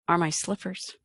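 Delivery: ordinary talk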